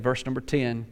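ordinary talk, nothing marked

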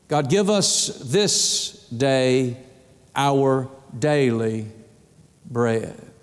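slow, low, with pauses around "our daily"; weighty emphasis. Shown as a demonstration. Preacher showing how to pray, slow, low emphasis. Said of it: "That's the emphasis".

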